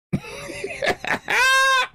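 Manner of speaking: a sharp, high burst of scornful laughter; high scoffing laugh; bitter laugh. Real, not acted. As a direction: laugh it off in disbelief. Scoffing at the offer.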